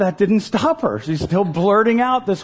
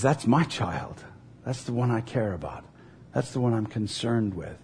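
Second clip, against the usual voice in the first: tender; 7 semitones lower, 8 dB quieter than usual. Acted. Father hearing his child call and claiming her, tender. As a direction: lower and quieten, speak with quiet care.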